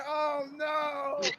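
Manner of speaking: high-pitched laughing groan; laughing dismay. Real, not acted. Reacting to Jimmy's head shoved in, laughing high. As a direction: squeal it through laughter. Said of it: "I'm crying".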